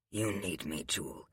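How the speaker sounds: hushed and tender reply; soft. A narrator's reading. Hero answers softly.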